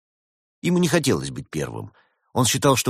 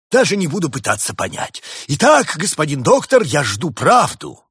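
high, loud insistence; imperious demand; 9 semitones higher, 9 dB louder than usual. Acted. Spirit demands the truth from the doctor, imperious and loud. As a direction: demand it grandly, pitch held up high.

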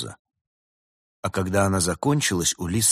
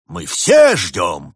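loud, slow, grandiose declaration; pompous; 3 semitones higher, 10 dB louder, much slower than usual. A narrator's reading. Summer spirit declares all are waiting, pompous and drawn-out. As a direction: draw it out with mock grandeur. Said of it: "пафосно заявил дух лета".

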